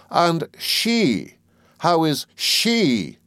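high, drawn-out, insistent questions; sharp aunt demanding an answer. A narrator's reading. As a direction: press him sharply, stretching the last word high. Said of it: "said my aunt sharply".

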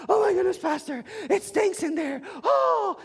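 very high, loud squeal of disgust; disgusted church ladies. Retold or imitated. Church members complaining about the smell, squealing high. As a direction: shriek about the stink. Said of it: "they're pinching their nose".

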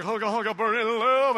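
rock-and-roll singer impression, swelling on the last word. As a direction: play a showy concert king throwing it down. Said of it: "He was like".

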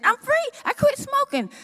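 very high, bright rush of joy; excited news. Retold or imitated. Phoning her pastor to announce she quit, very high and excited.

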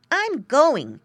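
high, loud little pig voice; a piglet making up his mind; high loud piglet resolve; determined piglet. A narrator's reading. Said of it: "said Squinty to himself".

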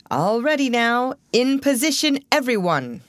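high-pitched call to the cast; stage director's ready call. A narrator's reading. Director calling cast to places.

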